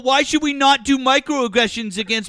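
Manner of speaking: loud and high, indignant; accusing a friend of disrespect, loud and heated; heated outburst. Real, not acted. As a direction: rail at him furiously. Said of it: "What are you even talking about?".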